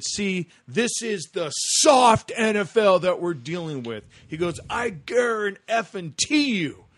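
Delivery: Michael Irvin. Retold or imitated. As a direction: imitate a worked-up pundit, drawing words out. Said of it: "But Michael Irvin went off".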